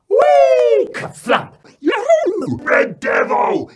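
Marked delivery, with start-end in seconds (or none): 1.8-3.7 put-on loud whooping children at play; boisterous play. Children dive bombing in front room, boisterous yelling. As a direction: yell it out like a wild stunt game.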